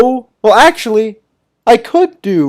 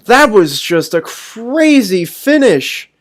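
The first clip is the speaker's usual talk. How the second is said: ordinary talk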